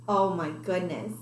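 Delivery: ordinary delivery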